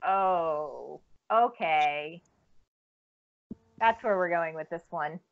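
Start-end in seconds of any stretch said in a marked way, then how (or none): 0.0-2.2 deflated reaction. Retold or imitated. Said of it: "And then you're like".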